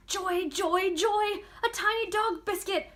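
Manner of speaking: high, loud squeal of delight; a tiny hamster beside himself with joy; overjoyed. A narrator's reading. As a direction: hamster gets a dog biscuit, squealing with joy. Hamster thrilled by a treat.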